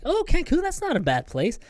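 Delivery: high, fluting mock nurse. Older nurse humoring the drowsy patient, high and chirpy. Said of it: "the older nurse are like".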